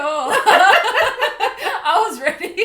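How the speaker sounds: high, drawn out, laughing; laughing admission. Real, not acted. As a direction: giggle the confession out slowly. Confessing, laughing.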